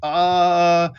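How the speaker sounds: high-pitched, flustered sentry voice; hesitant, high guard correcting himself; bumbling guard. Acted. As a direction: fumble the official challenge in a high voice. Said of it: "the guard on one of the towers hails you".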